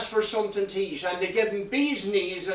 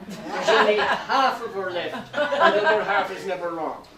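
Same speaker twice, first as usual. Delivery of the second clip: laughing; 4 dB louder than usual. Real, not acted. Laughs through the punchline.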